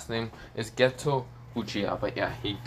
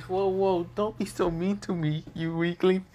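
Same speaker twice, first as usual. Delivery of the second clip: higher-pitched teasing plea; mock-wounded, pitched up; playful mock protest; 9 semitones higher than usual. Issei teases the rival who threatened to scream, in playful mock protest.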